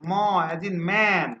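drawn-out, clear "ম as in man"; slow model pronunciation. Shown as a demonstration. Teacher modelling the sound for students, slow and clear. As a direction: stretch "man" slowly for the class to copy. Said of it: "এরপরে আমরা যেটা শিখবো".

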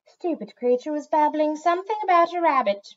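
high, loud, scornful put-down; disdainful cat. A narrator's reading. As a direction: sneer it as a haughty cat.

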